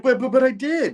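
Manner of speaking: high, stammering self-defence; mock protest. Retelling the blamed guard answering back, high mock protest.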